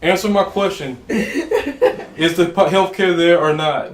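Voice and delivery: loud, high-pitched playful grilling; mock interrogation. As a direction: press them like a politician under questioning, half laughing.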